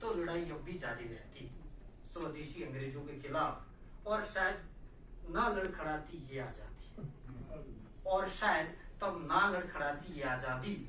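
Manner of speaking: declaim, then bring the poem down to rest; poetry recital. Acted. Poet ending a poem on faltering freedom, declamation easing down.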